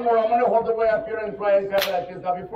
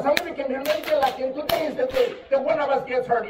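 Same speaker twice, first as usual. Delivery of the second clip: normal talking voice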